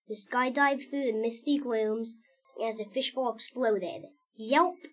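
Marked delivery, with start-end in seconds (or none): 4.4-4.8 yelp. Acted. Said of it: "I heard my bear say".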